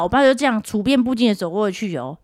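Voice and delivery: usual speaking voice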